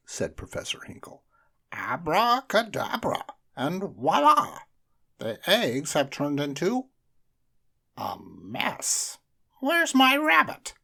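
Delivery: high, loud hammed-up magician; a showy magician whose trick fails; bumbling magician. A narrator's reading. Magician performing his failed trick for the children.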